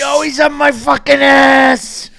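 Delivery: over-the-top screaming match; screaming rage. Acted. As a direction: scream it at your wife, all control gone. Acted marital screaming match.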